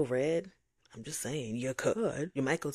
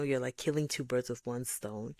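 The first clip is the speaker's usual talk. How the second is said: ordinary talk, nothing marked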